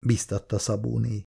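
usual speaking voice

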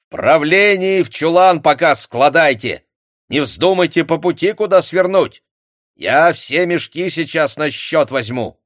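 high loud orders to the men; loud command. A narrator's reading. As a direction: warn them sternly. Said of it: "скомандовал". (громкая команда)

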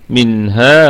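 منها held with a slow natural mad; pausal demo. Shown as a demonstration. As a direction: stop on منها, vowel held two counts. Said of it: "ونقف هكذا". (وقف على منها بمد طبيعي)

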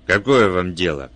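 loud, indignant challenge; mild growl. A narrator's reading. Man challenges the intruder who shoved in, mild growl. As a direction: push it out forcefully, with restrained anger. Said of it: "зарычал он".

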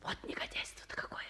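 fast, hissed whisper; muttered in scorn under the breath; disgusted whisper. Acted. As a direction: whisper it in contempt. Disgusted aside on the phone.

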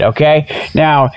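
usual speaking voice

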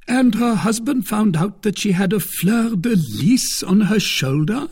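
high, incredulous question; astonished cry. A narrator's reading. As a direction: exclaim the question in shock. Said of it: "cried Porthos".

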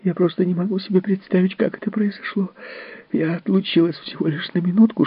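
high, tearful defensive nanny; upset nanny outburst. A narrator's reading. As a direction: blurt it out, close to tears. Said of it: "выкрикнула вдруг Мэнди".

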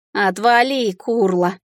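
ordinary delivery

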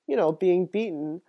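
ordinary delivery

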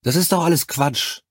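high-pitched, dismissive outburst; indignant protest. A narrator's reading. Charlie dismissing it all as nonsense, indignant protest.

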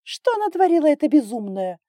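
high, loud hysterical outcry. A narrator's reading. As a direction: exclaim in a shrieky panic. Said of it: "истерично воскликнула она". (истерично, громко и высоко)

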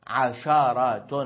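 عشرة said as ʕasharah, fatha on the shin; open shin. Shown as a demonstration. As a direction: give عشرة a clear a after the shin. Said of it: "بفتح الشين". (عشرة بفتح الشين)

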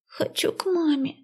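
put-on high, teary child's voice; a small girl crying for her mom; tearful little girl. A narrator's reading. Crying little sister wants mom. (плачущая девочка)

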